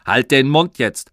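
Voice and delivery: loud angry shout. Acted. Father shouting at son during radio show, loud. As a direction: snap at the boy to shut up. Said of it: "hat Papa gerufen".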